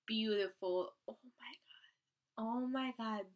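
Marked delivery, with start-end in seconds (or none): 1.1-2.4 a fan lost for words whispers. Real, not acted. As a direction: let it out in a hushed sigh. Whispered awe.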